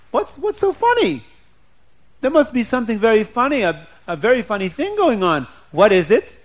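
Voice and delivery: high, loud wife pressing for an answer; demanding to know. Acted.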